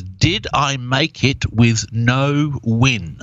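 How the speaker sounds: spelled out slowly, word by word; slow quoted reversal. Retold or imitated. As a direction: read each word out slowly and clearly. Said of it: "and he says".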